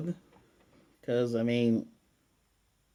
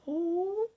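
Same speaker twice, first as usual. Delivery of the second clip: high, drawn-out cooing "aww"; awe moment. Acted. Fan recapping a sweet family moment, cooing. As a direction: let out a high melting aww. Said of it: "such an awe moment".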